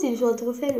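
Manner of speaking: ordinary talk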